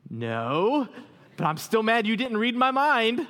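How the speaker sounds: sheepish laughing admission. Retold or imitated. His sheepish answer, laughing.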